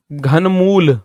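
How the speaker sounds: ordinary talk, nothing marked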